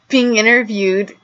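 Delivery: ordinary talk, nothing marked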